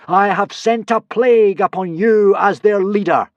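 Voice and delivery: high, loud solemn boom. Acted. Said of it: "boomed Hiccup solemnly".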